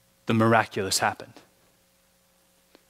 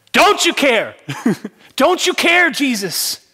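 high, loud, accusing cry; frightened disciples crying out; desperate plea; 13 semitones higher, 8 dB louder than usual. Acted. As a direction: demand he answer. Disciples plead with sleeping Jesus.

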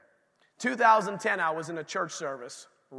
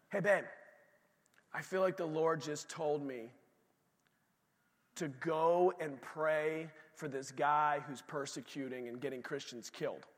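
softer, casual Ananias breaking news to his wife; a hint of a husband confessing, softer; 3 semitones lower, 8 dB quieter than usual. Ananias telling his wife God's command, softer and casual. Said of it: "This is how I talk".

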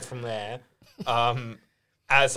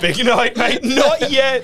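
bouncer; 7 semitones higher, much slower than usual. Retold or imitated. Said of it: "Benzie's bouncer voice".

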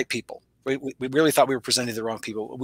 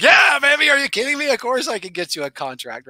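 inner excitement; 11 semitones higher than usual. Retold or imitated. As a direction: let the hidden thrill burst out, then settle. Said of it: "But on the inside, I'm like".

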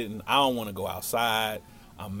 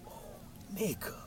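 much softer, higher murmur of amazement; awed hush; 9 semitones higher, 13 dB quieter than usual. Retold or imitated. Boy reacting to the beautiful girl, hushed awe. Awestruck aside to friend.

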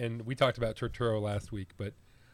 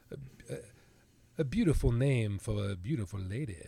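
put-on lazy Southern accent; 2 semitones lower than usual. Podcaster quoting an actor's line in a lazy Southern accent. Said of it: "such a lazy, typical Southern accent".

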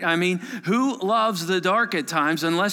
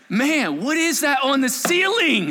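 louder, higher mock wonder; hammed-up amazement; 8 semitones higher, 5 dB louder than usual. Counselor tricking campers into staring at the ceiling, with hammed-up amazement. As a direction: sell the fake sight with big mock awe. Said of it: "I'm like".

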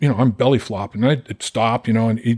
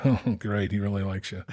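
dry irony; 2 semitones lower, 9 dB quieter than usual. Real, not acted. Ironic aside.

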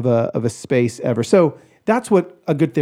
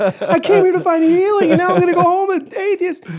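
high, whining lament; mock whiny voice; 4 dB louder than usual. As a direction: whine it like a wounded believer.

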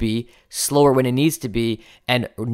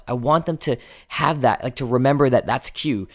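ordinary talk, nothing marked

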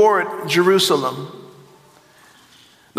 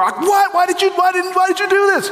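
outraged accusation; 10 semitones higher than usual. Retold or imitated. Friend hearing about the covid exposure, high indignant mock shout. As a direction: imitate a friend shrieking blame. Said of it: "people would either respond with".

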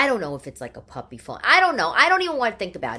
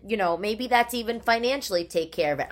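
normal talking voice

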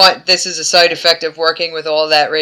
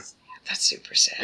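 whispered sad; 11 dB quieter than usual. Real, not acted. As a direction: whisper it, sorry for the character. Sad moment in the recap.

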